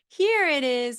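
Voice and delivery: "Here it is" pitched high and loud; exaggerated model. Shown as a demonstration. Therapist modeling a phrase to a child, pitched high. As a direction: lift "Here" way up. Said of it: "the first one is".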